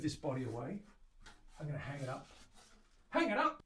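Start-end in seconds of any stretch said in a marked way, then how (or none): 3.1-3.6 high, loud exclamation; playful shout. Pretend. Maker shouting the next step to himself, playful shout.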